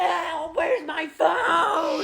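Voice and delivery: slow crying shout; panicked wail. Acted. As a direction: wail it near tears. Drunk panics over lost phone.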